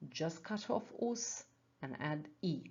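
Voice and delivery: usual speaking voice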